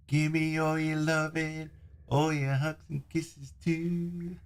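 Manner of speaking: high, slow sweet-talking voice; sing-song plea for love; cutesy voice filter. Shown as a demonstration. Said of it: "that's the voice that you get".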